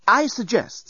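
firm. A narrator's reading. Nettie proposing a plan to Dan, firm. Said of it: "said Nettie firmly".

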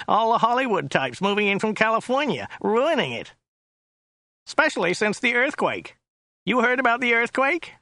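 high, nasal complaining driver; Joe's whiny voice. A narrator's reading. Driver complaining about newcomers to his passenger, high and whiny. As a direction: grumble in a high whine. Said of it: "His voice was rather high and whiny".

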